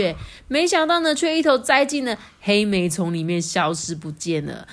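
ordinary delivery

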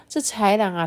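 ordinary delivery, nothing marked

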